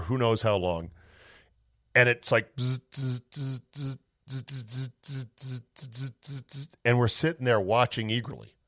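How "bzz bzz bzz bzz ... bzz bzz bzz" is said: soft mechanical bzz imitation, fading; quiet imitated machine buzzing; printer buzz. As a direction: buzz like a failing printer, dying away.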